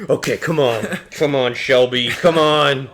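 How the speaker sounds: lightly voiced nervous man psyching up; nervous self pep talk. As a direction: mutter a pep talk to yourself. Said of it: "Just like".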